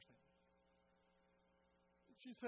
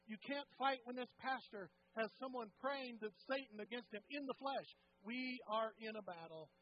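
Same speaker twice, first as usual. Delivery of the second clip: loud, higher, hammering refrain; preaching at full force; 5 semitones higher, 8 dB louder than usual. Acted. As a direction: pound each repetition harder. Preacher builds with repetition.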